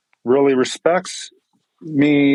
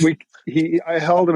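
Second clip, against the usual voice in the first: ordinary delivery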